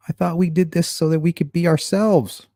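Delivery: hammed-up naive complaint; duped follower protesting. Acted dialogue of duped people.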